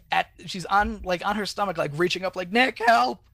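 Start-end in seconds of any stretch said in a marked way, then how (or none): 2.5-3.2 higher, louder imitated call; mock cry for help. Kimber on her stomach calling to Nick, mock cry for help. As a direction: call out as if pleading for rescue.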